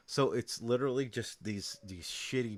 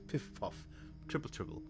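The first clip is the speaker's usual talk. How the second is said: mock English nonsense; 2 semitones lower, 7 dB quieter than usual. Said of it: "it's in an accent".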